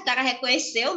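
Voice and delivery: ordinary delivery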